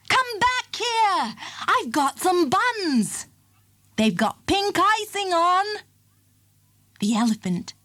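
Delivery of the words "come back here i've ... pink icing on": high, slow coaxing call. A narrator's reading. Circus man calls the elephant back with buns, high and slow. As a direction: lure him back with a treat. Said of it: "he called after the elephant".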